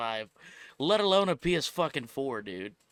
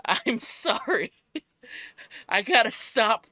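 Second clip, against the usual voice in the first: laughing; 8 semitones higher, 4 dB louder than usual. Real, not acted. Laughing fit at trailer.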